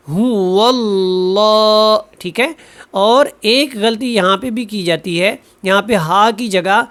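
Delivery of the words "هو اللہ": هو اللہ with thick full ل in اللہ, slow; heavy lam. Shown as a demonstration. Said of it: "اس کو موٹا پڑھنا ہے". (اللہ کا لام موٹا)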